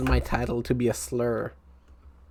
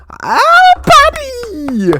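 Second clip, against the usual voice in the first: loud, high excited greeting; excited shout; 11 dB louder, much slower than usual. Real, not acted. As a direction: call out his name, thrilled. Greeting a chat regular excitedly.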